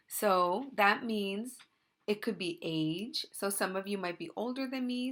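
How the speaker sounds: ordinary talk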